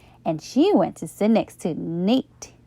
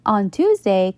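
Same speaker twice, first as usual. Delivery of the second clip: plain reading voice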